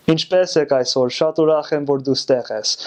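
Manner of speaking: throaty Armenian accent; native Armenian accent. Shown as a demonstration. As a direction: speak Armenian from the throat, like a local. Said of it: "from the throat".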